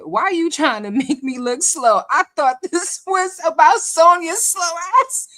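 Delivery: high, loud, breaking into laughter; laughing mock complaint. Host voicing a viewer's joke complaint, cracking up. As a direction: read the complaint through rising laughter.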